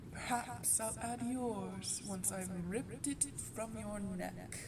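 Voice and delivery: soft, unhurried villain's taunt; quiet menace. Acted. Vampire greeting a hunter sent to kill it, quietly menacing. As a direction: toy with the intruder, keeping it hushed.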